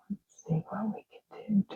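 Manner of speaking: breathy unvoiced whisper, absorbed; soft whisper to nobody. Real, not acted. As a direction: whisper like Bob Ross at the easel. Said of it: "I don't know why I'm whispering".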